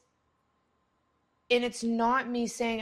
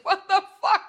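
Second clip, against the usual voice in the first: startled yell; 10 semitones higher, 7 dB louder than usual. Retold or imitated. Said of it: "I'm like".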